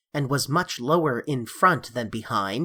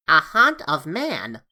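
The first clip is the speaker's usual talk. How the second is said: slow, louder wondering troll; lightly voiced troll in awe; 4 dB louder than usual. A narrator's reading. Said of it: "said the troll".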